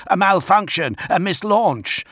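high, urgent exclamations; shouted protest. A narrator's reading. Farith Redloss protests to the first master, high and urgent. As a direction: insist on it in two loud bursts. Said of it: "Farith Redloss shouted".

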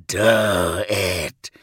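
low, rasped urging; rasping. A narrator's reading. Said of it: "he rasped".

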